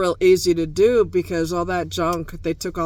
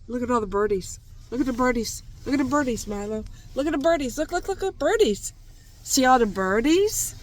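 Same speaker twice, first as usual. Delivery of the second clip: higher, sing-song pet talk; 6 semitones higher, faster than usual. Real, not acted. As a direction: coax the dog to look. Talking to the dog.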